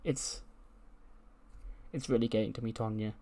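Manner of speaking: soft, halting sulk; mock tearful. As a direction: retell a sad man struggling to speak. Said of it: "And he cries".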